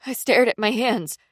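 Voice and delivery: plain reading voice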